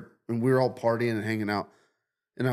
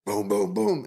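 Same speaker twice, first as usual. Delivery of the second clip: gunshot sounds; 8 semitones higher, 3 dB louder than usual. Retold or imitated. Imitating gunshots in story.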